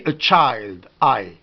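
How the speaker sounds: put-on "child" with an eye vowel, low falling voice; long English i. Shown as a demonstration. Teacher showing one sound of English I, long eye. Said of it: "On prend I".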